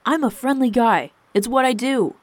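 loud, high-pitched defensive protest; exasperated self-justification. A narrator's reading. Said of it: "Seb rose his voice".

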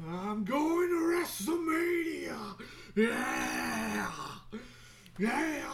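high, slow, drawn-out wrestler shout; hammed-up promo yell, stretched and high; wrestling promo. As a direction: holler it like a hyped-up wrestler. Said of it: "doing a promo".

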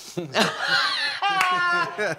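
high, squealing burst of laughter. Real, not acted. Bandmate amused by the Faro rhyme, high laughing outburst.

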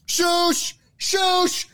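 high, hammed-up shushing; a high put-on voice shushing the co-host; mock shushing. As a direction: shush him like a fussy librarian.

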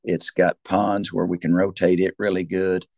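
normal talking voice

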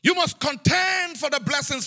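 emphatic, ringing sermon delivery; full-force preaching. Real, not acted. Preacher telling of a needed blessing, full-force preaching. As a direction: drive each phrase home to the congregation.

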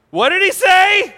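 high, loud, outraged disbelief; acted panicked yell; incredulous shout. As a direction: yell it as if you can't believe your ears. Acting out stunned, panicking disciples.

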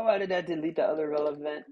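usual speaking voice

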